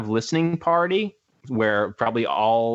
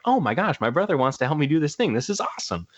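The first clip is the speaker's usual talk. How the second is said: excited; 6 semitones higher than usual. Retold or imitated. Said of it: "It was like".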